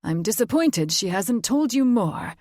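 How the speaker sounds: lightly voiced fae father, soured; sour note. A narrator's reading. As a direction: let the disappointment curdle. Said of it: "the sour note in his voice".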